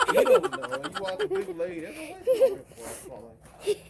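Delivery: high-pitched laughter through words; squealing with laughter while teasing; laughing. Real, not acted. Teasing the walk, laughing hard.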